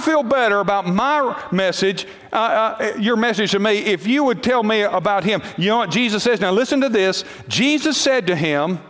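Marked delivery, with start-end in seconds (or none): none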